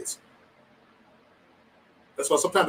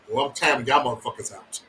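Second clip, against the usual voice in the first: normal talking voice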